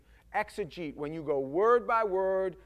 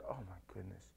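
very low, hushed mock dismay; dismayed astonishment; 12 semitones lower, 16 dB quieter than usual. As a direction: say it under your breath, as if appalled. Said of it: "where he's like this".

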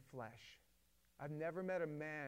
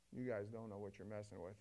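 lower, grim voice of someone who lived it; Eastern Bloc warning; 6 semitones lower than usual. Retold or imitated. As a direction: warn them darkly from experience. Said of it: "and they go".

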